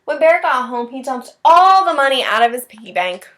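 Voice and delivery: loud, eager read-aloud narration; loud lively storybook telling; excited story narration. Acted. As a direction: tell it big for the kids.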